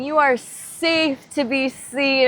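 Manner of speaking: a strong spoken affirmation; affirmation said aloud. Shown as a demonstration. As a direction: declare it openly to the group. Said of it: "All of these people together saying".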